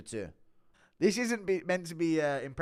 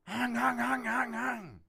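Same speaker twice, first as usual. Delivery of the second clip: high nasal honking hang-hang noise, held on one high pitch; six nine impression; 11 semitones higher, much slower than usual. Streamer answers a request for an impression, high honking mimicry. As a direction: honk out a high repeated "hang". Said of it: "Do a six nine impression".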